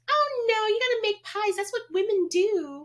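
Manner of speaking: high, loud mock-horrified sing-out; Sarah. Imitating a camp leader correcting a girl who refuses pies, hammed-up. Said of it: "Sarah's like".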